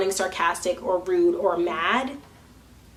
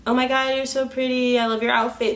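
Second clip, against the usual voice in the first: gushing compliment; 4 semitones higher than usual. Retold or imitated. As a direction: lay on a gushy, scripted compliment. Gushing scripted compliment to strangers.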